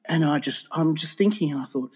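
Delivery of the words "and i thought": usual speaking voice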